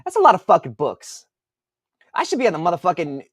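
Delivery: rising heat, cursing at the numbers; getting mad at his own sales figures; worked-up cursing. Real, not acted. Author ranting to viewers, worked-up cursing. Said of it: "why am I getting mad?".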